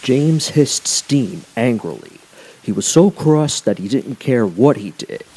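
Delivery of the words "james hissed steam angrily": ordinary delivery, nothing marked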